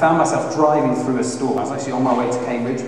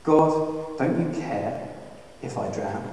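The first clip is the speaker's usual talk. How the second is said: hushed plea to God; quiet plea; 8 dB quieter, slower than usual. Shown as a demonstration. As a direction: let it sink almost to nothing. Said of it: "one of the first questions anyone ... asks".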